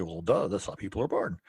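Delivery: ordinary talk, nothing marked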